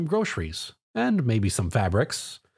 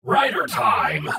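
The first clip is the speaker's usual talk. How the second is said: slow, louder, lower heroic call; battle cry; 5 semitones lower, 4 dB louder, much slower than usual. Acted. Tom calls out as he transforms to fight, loud and slow.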